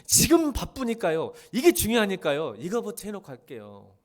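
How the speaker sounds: loud, slow put-upon excuse; exaggerated busy-person excuse; mock excuses. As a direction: drag out the busy excuse, mocking it. Mimics excuses to the congregation.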